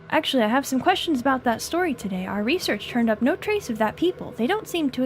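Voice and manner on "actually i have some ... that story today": ordinary delivery, nothing marked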